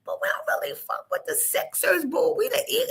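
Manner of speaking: high, laughing mock voice; mock Sixers fan rant, high and giggly. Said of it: "I'm like".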